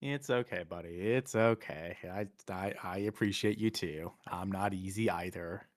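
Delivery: soft, tender comforting voice; gentle reassurance. Acted. Character answering a friend's apology in a hug, soft reassurance. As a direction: comfort a friend quietly, almost murmured.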